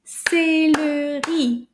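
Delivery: CÉLERI drawn out in separate, loud syllables. Shown as a demonstration. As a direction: clap out each syllable of Céleri, slowly. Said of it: "going to clap them together".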